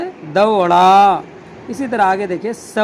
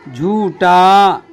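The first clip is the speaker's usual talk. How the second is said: slow, drawn out; 5 dB louder, much slower than usual. Shown as a demonstration. Teacher modelling झूठा for learners, slowly drawn out. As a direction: say झूठा slowly with long vowels. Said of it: "टा".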